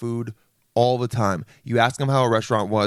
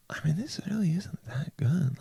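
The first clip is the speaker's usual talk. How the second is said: hushed, higher-pitched whisper; imitated diner's secret whisper; whispered doubt; 6 semitones higher, 8 dB quieter than usual. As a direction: confide it under your breath. Diner's private doubt, acted.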